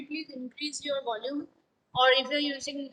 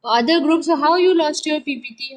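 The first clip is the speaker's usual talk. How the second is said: scolding; 3 semitones higher, 9 dB louder than usual. Real, not acted. Teacher scolding about lost file.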